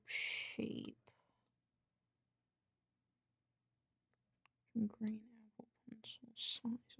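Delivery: whispering. Real, not acted. Whispering while drawing.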